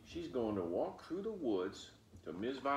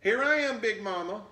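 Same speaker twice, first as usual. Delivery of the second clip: put-on high, loud, bright child calling out; little girl voice; 12 semitones higher, 11 dB louder than usual. Girl calling back to her grandmother, high and loud. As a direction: call out like an eager little girl.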